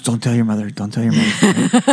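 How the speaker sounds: a hint of secretive grandma; hushed grandma. Grandma hushing grandson about mother. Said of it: "She goes".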